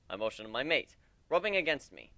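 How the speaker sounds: plain reading voice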